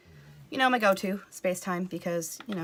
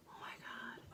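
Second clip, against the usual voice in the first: hushed whispered worry; anxious whisper; 7 semitones lower, 20 dB quieter than usual. Acted. Worrying about her in-laws, whispered. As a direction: whisper it like a guilty secret.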